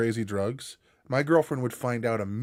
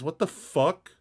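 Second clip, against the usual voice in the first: usual speaking voice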